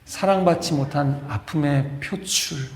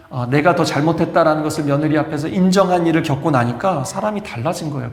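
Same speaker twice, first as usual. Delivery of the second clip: normal talking voice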